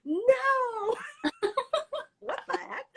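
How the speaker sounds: high, laughing mock refusal. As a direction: squeal no through laughter. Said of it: "And I'm like".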